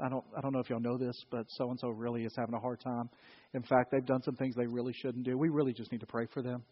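hushed gossip. Pretend. Church member sharing gossip as a prayer request, hushed. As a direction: lean in and spill the gossip softly.

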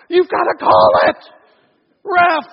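full-volume sideline shout; loud, indignant sports-parent yell; parent yelling at referee. Retold or imitated. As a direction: holler at the ref from the stands. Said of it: "when I want to yell at the referee".

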